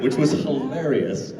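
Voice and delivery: ordinary talk, nothing marked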